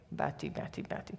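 very soft calling of Betty; gentle God voice. Retold or imitated. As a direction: call her name softly like a patient father. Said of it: "toen zei God tegen mij".

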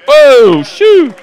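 very high, very loud whooping cry; excited exclamation. Real, not acted. Marvelling at the woman's healing, whooping loud. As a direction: let out a gleeful whoop.

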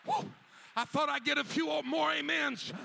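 ordinary talk, nothing marked

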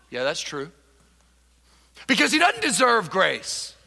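ordinary talk

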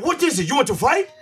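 high-pitched mock challenge to fight; imitated confrontational challenge. Acted challenge from his opponent.

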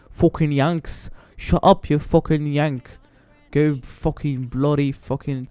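lightly voiced British kid mocking Yanks; mock British gamer. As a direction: mimic the UK players' taunt in a British accent. Imitating UK players insulting him.

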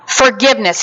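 ordinary talk, nothing marked

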